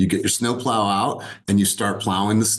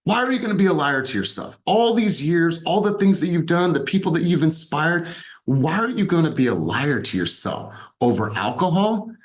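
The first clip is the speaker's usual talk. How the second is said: pleading rebuke; 7 semitones higher than usual. Retold or imitated. Friend confronts, rising intensity.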